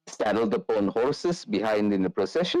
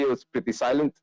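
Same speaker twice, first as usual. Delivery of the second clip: ordinary talk, nothing marked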